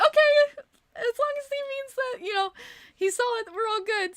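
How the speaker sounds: high, loud, laughing through the words; giddy mock acceptance; laughing relief. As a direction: squeal it out, half laughing. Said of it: "I was like".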